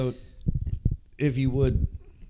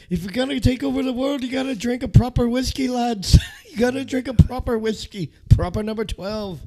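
high, quick Irish pub banter; hammed-up Irish salesman; Irish accent; 6 dB louder than usual. McGregor selling whiskey impression.

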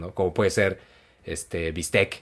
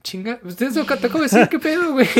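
much higher, louder indignant retort; squealing outrage at the insult; offended self; 10 dB louder than usual. Retold or imitated. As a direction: replay your own shocked outrage. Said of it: "Me sentí ofendido".

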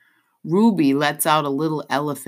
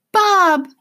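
high, loud cry of dismay; 9 dB louder than usual. A narrator's reading. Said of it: "she cries".

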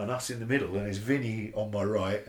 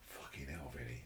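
hushed awe; 8 semitones lower, 13 dB quieter than usual. Retold or imitated. Awed remark to Vinny after.

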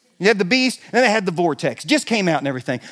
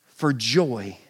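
ordinary delivery

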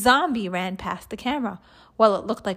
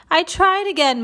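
higher-pitched, mildly moaned complaint; 9 semitones higher, 4 dB louder than usual. A narrator's reading. Teacher telling her pupils she has failed, mildly moaning. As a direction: moan it a little, higher than usual. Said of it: "moaned Miss Cuddy".

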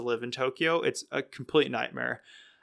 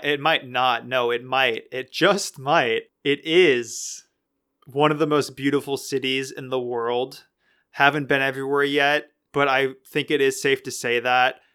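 ordinary talk

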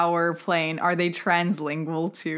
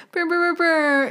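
imitated alarm buzz; 9 semitones higher than usual.

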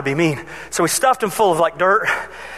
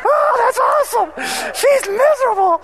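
gleeful laughter; 13 semitones higher than usual. Retold or imitated. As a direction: laugh with mischievous delight. Gleeful kids hiding in boat.

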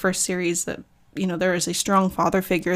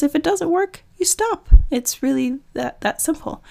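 light, much higher imitation of the therapist; therapist's voice; 8 semitones higher, 5 dB louder than usual. The therapist explaining medication, imitated in a much higher voice. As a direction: lift the voice high, matter-of-fact and simple. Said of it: "And then she goes".

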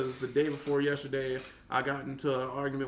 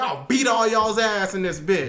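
louder, higher retold outburst; fired-up threat from inside your head; imagined threat; 6 semitones higher, 8 dB louder than usual. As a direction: blurt out the threat you held back. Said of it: "But in my mind".

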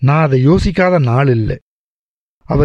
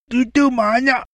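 put-on small child; 10 semitones higher than usual. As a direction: babble sleepily like a feverish toddler. Sick child babbles. (காய்ச்சல் குழந்தையின் மழலைக் குரல்)

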